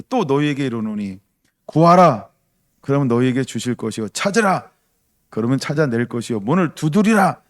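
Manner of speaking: loud, ringing Bible proclamation; preaching scripture. Acted. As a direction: proclaim each command to the hall. Reads scripture at full force. (말씀을 선포하듯 크게 외치며)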